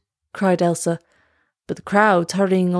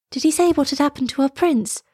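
put-on higher, young girl's question; anxious girl; 7 semitones higher than usual. A narrator's reading. Elsa asks about the missing prince, anxious and higher. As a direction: ask urgently in a higher voice.

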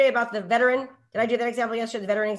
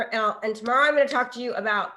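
ordinary delivery

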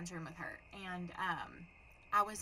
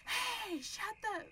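high-pitched mock yell; not nice; much slower than usual. Shown as a demonstration. Showing how she would not yell at the neighbors, mock holler. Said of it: "I was gonna say it nicely. I wasn't gonna be like".

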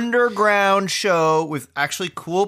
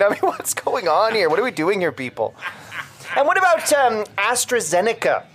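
normal talking voice

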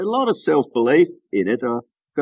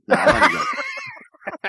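laughing; much slower than usual. Real, not acted. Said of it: "your accent is great".

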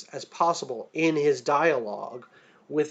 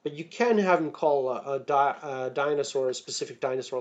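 ordinary delivery

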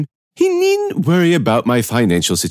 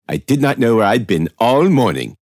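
plain reading voice

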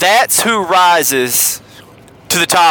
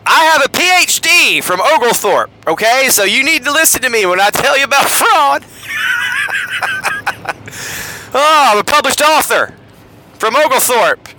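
mock boasting; 8 semitones higher than usual. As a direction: brag like a puffed-up fraud. Mocking fraudulent credential boasts.

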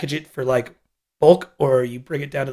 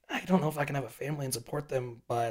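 whispering; 10 dB quieter than usual. Retold or imitated. Worried thought voiced as quote.

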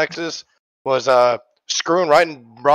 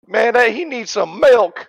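meme voice; 8 semitones higher, 4 dB louder, slower than usual. Pretend. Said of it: "perfect for the meme of".

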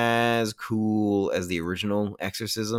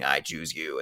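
a touch lower and softer, mock demon; understated creepy demon taking over the words; demon's voice; 4 semitones lower, 5 dB quieter, slower than usual. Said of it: "it becomes the demon's voice saying".

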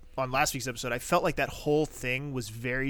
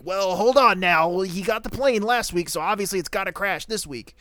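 mocking writers; 6 semitones higher, 8 dB louder than usual. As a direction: ham up the writers' smug logic.

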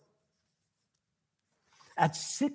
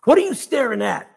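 gruff challenge, a bit higher and louder; testy, raised-voice question; 4 semitones higher, 7 dB louder than usual. A narrator's reading. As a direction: demand it gruffly, with some force. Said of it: "she asked gruffly".

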